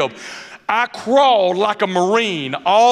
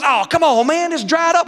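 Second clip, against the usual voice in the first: put-on coaxing young friend; 8 semitones higher than usual. Friend pleads in retold golf story.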